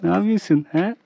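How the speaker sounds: high, playful pet talk. Real, not acted. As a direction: coo at the cat teasingly. Talking to a cute cat. (kediye sevgiyle seslenme)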